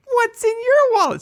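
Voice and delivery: put-on very high toddler voice; squeaky small-child impression; [name]. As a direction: imitate a little kid belting out a tagline. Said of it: "he's just like".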